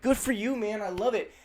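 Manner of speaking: high-pitched, approving cheer; sincere congratulation. Acted. Friend cheering another's news, sincere praise. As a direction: make it sound like you mean it. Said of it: "There are generally some times".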